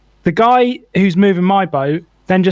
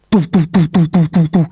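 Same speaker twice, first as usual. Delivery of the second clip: rhythmic imitation of a thumper engine; imitated engine thumping. Boater mimics neighbour's loud engine, imitated thumping. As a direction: thump out repeated engine boos. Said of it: "it's a really loud engine".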